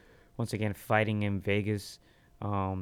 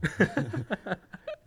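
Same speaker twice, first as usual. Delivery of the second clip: high laugh; 10 semitones higher than usual. Real, not acted. Laughs at his own punchline.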